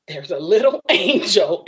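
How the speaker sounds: imitated shaken wonder, slightly broken; nervous, shaking. Mother spotting a little angel, imitated nervous voice. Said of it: "she was nervous, she was shaking".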